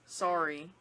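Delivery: "Sorry" with an open Canadian o. Shown as a demonstration. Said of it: "What else?".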